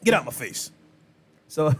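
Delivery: normal talking voice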